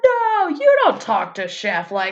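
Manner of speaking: high, loud, drawn-out scandalised cry; hammed-up retold indignation; mock outrage. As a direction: shriek it as an appalled crowd. Acts out everyone's outraged reaction.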